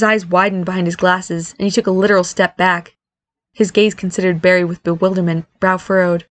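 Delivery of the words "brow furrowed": usual speaking voice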